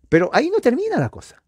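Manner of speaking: high, fast preacher's push; quick, lifted pulpit emphasis; emphatic build. Real, not acted. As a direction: drive the line home with rising zeal.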